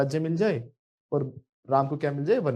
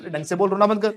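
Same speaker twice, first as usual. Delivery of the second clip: loud, stern mock scolding; scolding parent; 3 semitones higher, 8 dB louder than usual. Parent tells whining child to stop crying, scolding. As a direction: order the child sharply to stop.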